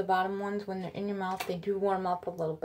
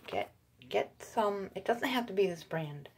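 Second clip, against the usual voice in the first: ordinary talk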